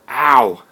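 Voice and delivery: sharp loud pained cry; real loud ow. Real, not acted. Vlogger after hitting his chair, loud pained ow. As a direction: yelp from the bump. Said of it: "That hurt".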